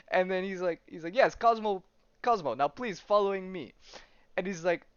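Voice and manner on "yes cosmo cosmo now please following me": put-on higher, lightly Russian-accented dog; mild mock Russian accent. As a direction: politely insist with a hint of Russian accent. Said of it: "it's in a Russian accent".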